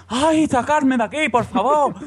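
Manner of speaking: put-on high, whiny child pleading to leave; as a little boy begging his parents to take him away from the fair; crying child. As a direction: imitate a small kid begging, half-sobbing. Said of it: "yo lloraba".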